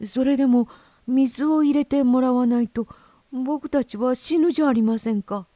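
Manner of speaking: high, pleading goldfish; insistent small-creature plea; desperate protest. Acted. Red goldfish protests it will die.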